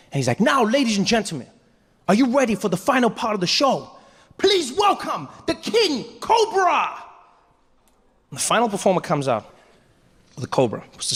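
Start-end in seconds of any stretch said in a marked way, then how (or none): 0.4-6.9 high, grand show announcer; Deng Basan. Retold or imitated. Show host introducing final act, grand announcer. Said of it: "Deng Basan came out one final time".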